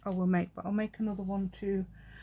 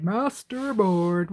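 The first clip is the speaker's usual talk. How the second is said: playful drawn-out; 7 dB louder, much slower than usual. Pretend.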